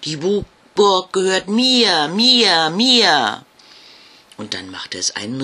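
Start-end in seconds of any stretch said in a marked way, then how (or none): 0.0-3.4 loud, high, possessive ghost shout. Acted. Big ghost yelling at Jasmina, loud possessive shout. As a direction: scream to claim the castle. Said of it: "und schrie".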